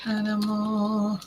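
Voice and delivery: ordinary delivery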